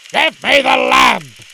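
very loud, drawn-out demand; raging cry. Acted. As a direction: dervish losing his temper. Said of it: "cried the dervish".

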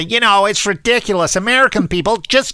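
acted-out exasperated objection; indignant complainer. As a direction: imitate someone scoffing at how Americans talk. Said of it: "people come up to me and say".

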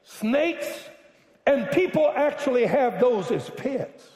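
high-pitched, incredulous exclamation; appalled outburst. Real, not acted. Preacher to congregation about hated snakes, appalled outburst.

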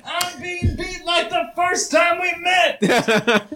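high, slow, shouted complaint; hammed-up yell. Coach Flaps yelling while beaten, high shout. Character being beaten yells.

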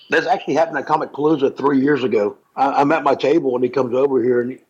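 ordinary talk

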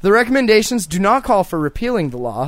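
usual speaking voice

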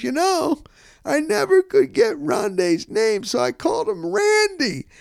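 a lightly voiced old football coach, amused at himself; imitating Monty.

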